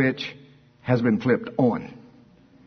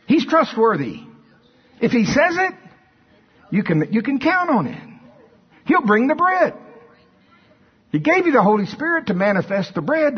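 high, urgent appeal to a congregation; impassioned preaching; 7 semitones higher than usual. Real, not acted. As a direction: press each point home, voice raised high. Preaches at full force.

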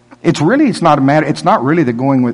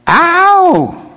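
mock pained yelp; 5 dB louder than usual.

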